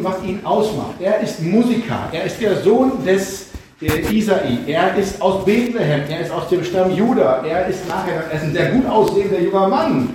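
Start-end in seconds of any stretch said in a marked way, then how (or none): none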